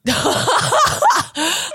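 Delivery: high squealing laugh; shocked burst of laughter. Real, not acted. Shocked at friend's joke.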